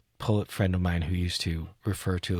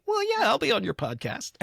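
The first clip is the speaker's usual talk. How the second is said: much higher, bright voiced poet agreeing; cheerful poet reply; faster than usual. Poet answering a podcast invite, a high cheerful voice. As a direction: play the famous poet saying yes. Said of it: "they'll say".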